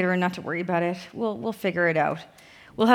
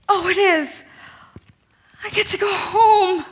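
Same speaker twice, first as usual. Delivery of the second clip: high, loud, slow and delighted; overjoyed; 9 semitones higher, 5 dB louder than usual. Acted. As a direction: gasp of joy then beam. Passport found, overjoyed.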